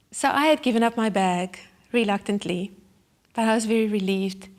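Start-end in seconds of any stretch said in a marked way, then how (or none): none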